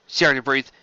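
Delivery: ordinary delivery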